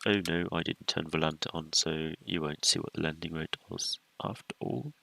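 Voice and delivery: usual speaking voice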